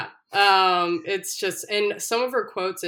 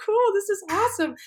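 mock cheer; 4 dB louder than usual. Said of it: "And they're all like".

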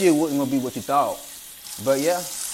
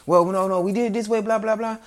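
mocking worker; 7 semitones higher than usual.

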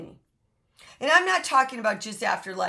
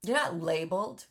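ordinary talk, nothing marked

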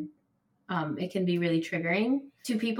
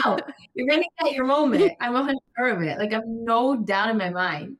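higher, louder, quick encouraging promise; excited reassurance; 4 semitones higher, 6 dB louder than usual. Real, not acted. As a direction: promise it to your friend with gusto.

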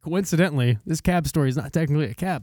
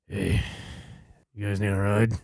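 put-on low, soft ninety-year-old voice; old man; 11 semitones lower, 8 dB quieter than usual. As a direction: play a feeble old fellow offering help. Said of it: "ninety fucking year old man".

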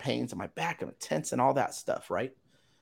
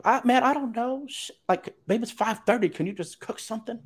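much higher, whiny exasperated pleading; frustrated exasperation; 14 semitones higher than usual. Retold or imitated. Exhausted husband to wife asking about dinner, exasperated and high. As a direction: groan it out, at the end of your rope. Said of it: "But I'm frustrated".

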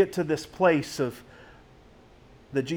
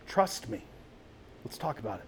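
much softer, tender invitation; gentle God voice; 4 semitones lower, 11 dB quieter than usual. Acted. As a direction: drop almost to a hush, inviting. God gently inviting about pain.

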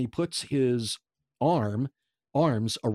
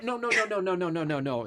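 frantic no; 7 semitones higher, faster than usual. Retold or imitated. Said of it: "I went".

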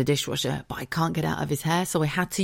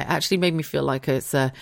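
ordinary talk

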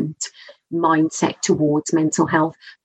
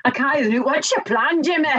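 higher, hammed-up Scottish voice; mock Scottish accent; 8 semitones higher than usual. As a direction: put on a jokey, direct Scot. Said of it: "We're Scottish".

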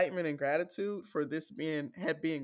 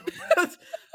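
high laugh; 14 semitones higher, 8 dB louder than usual. Real, not acted. Bursting laugh.

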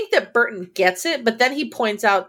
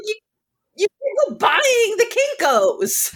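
high hammed-up yell, much higher; a comic high-pitched screech at her husband; mock yell; 12 semitones higher than usual. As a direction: shout it in a silly put-on voice. Acted shout in quote.

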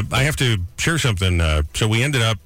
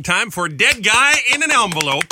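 segment announcement; 9 semitones higher than usual. Acted. Host announcing the morning segment, announcer style. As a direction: boom it out like a show bit title. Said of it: "segment announcement".

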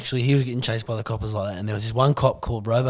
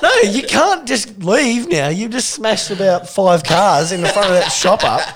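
1 bystanders scolding; 8 semitones higher, 5 dB louder, slower than usual. Retold or imitated. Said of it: "They're like".

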